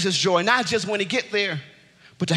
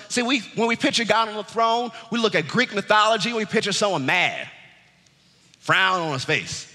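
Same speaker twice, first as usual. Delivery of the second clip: ordinary delivery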